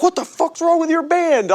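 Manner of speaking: high-pitched indignant demand; mock angry confrontation. Club guy confronts a stranded band member, mock outrage.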